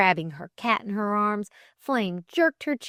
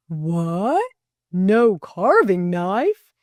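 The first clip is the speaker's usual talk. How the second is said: loud, drawn-out outraged question; imperious roar; 7 dB louder, much slower than usual. A narrator's reading. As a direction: demand it imperiously, stretching the words. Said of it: "he roared imperiously".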